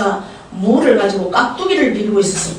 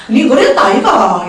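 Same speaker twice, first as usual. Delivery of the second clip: Gyeongsang dialect; 5 dB louder than usual. Acted. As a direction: answer bluntly as God in Gyeongsang dialect. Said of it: "경상도 말로 답해 주십니다". (경상도 사투리로 툭 던지듯)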